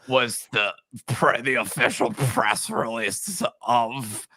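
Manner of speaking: slow, put-on man-sounding voice reading a press release; lightly voiced old man, deliberately slow; mock old man voice. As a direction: drag it out like an eighty-year-old man reading. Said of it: "This is a fictional impression".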